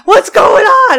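high, very loud mock yell; alarmed question. Friend on the phone demanding news, alarmed. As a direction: shout it in retold panic.

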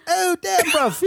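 high, loud mock-British exclamation; British accent. Friend tries screaming movie line British-style, loud British accent. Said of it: "do that with a British accent".

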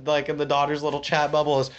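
normal talking voice

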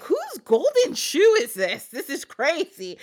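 high, slow, baffled servant voice; puzzled servants. Acted. Servants finding a shoe by the fountain, high and baffled. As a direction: drag it out, bewildered.

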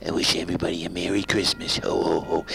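lightly voiced Clinton; Clinton impression. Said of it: "President Clinton".

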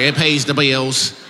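slow, heavy Southern twang on pays the bills; twangy drawl. Retold or imitated. Comic mimicking an audience member with an overdone twang. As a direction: drag it out with a thick twang. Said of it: "You didn't have that much twang".

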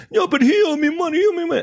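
high, insistent mocking voice; mock insistent woman. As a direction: whine it high like her.

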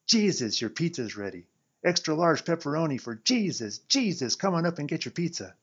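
loud, high preacher-style announcement. Acted. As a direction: call it out like a televangelist. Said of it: "like one of those flabby-jowled T V preachers".

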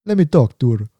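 badly imitated Jamaican guy; amateur Jamaican accent; terrible Jamaican accent. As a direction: try a Jamaican accent for a flirty request. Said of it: "this is a terrible Jamaican accent".

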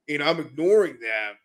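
normal talking voice

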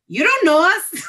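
high, loud mock disbelief; retold squeal of indignant disbelief; incredulous protest. Woman retelling her protest to a bouncer, incredulous.

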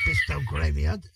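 low, incredulous echo of the offer; retold disbelief. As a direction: repeat it as a scornful question. His incredulous reaction to the job.

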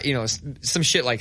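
ordinary talk, nothing marked

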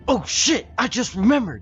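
high, loud startled yelp; sudden panic. Acted. Gnome yelping in panic before bolting out. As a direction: yelp it high as if it just hit you.

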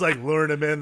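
usual speaking voice

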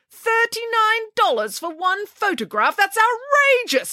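high, slow, shocked outrage; outraged. Acted. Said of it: "declared Nanny Piggins".

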